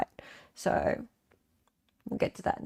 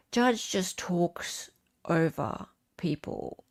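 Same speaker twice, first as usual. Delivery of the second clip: sighing whisper; 7 dB quieter, slower than usual. Real, not acted. Sighing aside about the judge.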